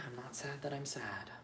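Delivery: breathy whisper, letting it out. Real, not acted. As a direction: whisper it to yourself. Quiet emotional aside.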